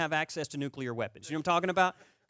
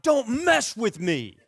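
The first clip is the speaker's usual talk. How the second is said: slow, loud tough-guy line through a laugh; laughing, hammed-up karate-kid threat; 7 semitones higher, 7 dB louder, much slower than usual. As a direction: growl it like a movie hero. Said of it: "I was like".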